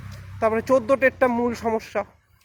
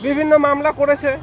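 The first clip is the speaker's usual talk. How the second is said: raised voice; 4 semitones higher, 4 dB louder than usual. Real, not acted. Urgent appeal for unity to followers.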